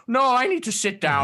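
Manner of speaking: put-on high, curious old turtle creature voice; a wary hermit peering at strangers; old turtle man. GM voicing the old turtle hermit to the party.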